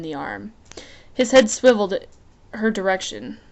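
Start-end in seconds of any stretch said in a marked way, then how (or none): none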